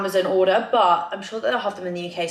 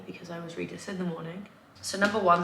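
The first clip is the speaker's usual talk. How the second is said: usual speaking voice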